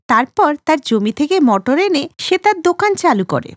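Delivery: plain reading voice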